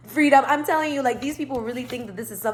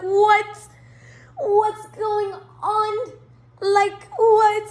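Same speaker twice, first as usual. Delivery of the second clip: loud, much higher, slow disbelief; shocked; 12 semitones higher, 6 dB louder, much slower than usual. Retold or imitated. To the Uber driver who was a Marine, shocked. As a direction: squeal out the questions.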